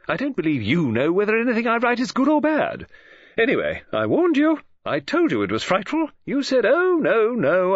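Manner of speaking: high, agitated grumbling at an absent publisher; Mrs Oliver's exasperated rant. A narrator's reading. Mrs Oliver scolding an imaginary publisher, high and exasperated.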